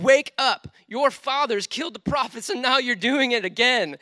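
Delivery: high, loud call to wake up; prophetic shout. Retold or imitated. Stephen confronts the hostile crowd, shouting them awake. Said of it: "he's essentially saying".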